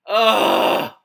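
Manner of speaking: a sudden high, loud groan of disgust. Real, not acted. Reader recoiling from the book with a loud groan. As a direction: let out a loud disgusted ugh.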